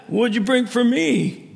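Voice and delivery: high, slow mock demand from the neighbor. On the boat, imitating the hungry neighbor demanding lunch, high and slow. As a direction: mimic the gruff neighbor asking what you brought him.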